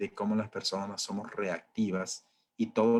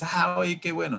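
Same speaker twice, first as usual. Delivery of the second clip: mock cheerful; 7 semitones higher than usual. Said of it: "te vas a resignar feliz".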